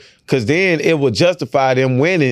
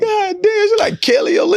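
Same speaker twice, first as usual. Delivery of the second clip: laughing tease. Real, not acted. Mocking friend, high pitch.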